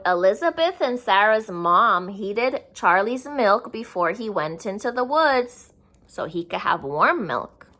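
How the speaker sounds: ordinary delivery, nothing marked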